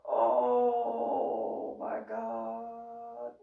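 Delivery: very slow, stretched-out words; long-drawn grandpa lament; exasperated groan. Retold or imitated. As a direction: drag each word out in mock despair. Grandpa's despair, hugely drawn out.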